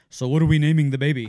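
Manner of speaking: a hint of a big accented nurse; deep-voiced accented nurse. Father imitates nurse asking baby's name, lightly accented nurse voice. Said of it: "deeper voice than I would have perhaps".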